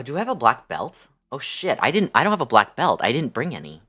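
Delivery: ordinary talk, nothing marked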